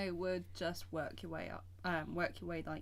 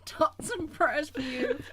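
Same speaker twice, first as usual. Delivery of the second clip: laughing; 9 semitones higher, 8 dB louder, slower than usual. Pretend. Laughing mock-sorrow echo.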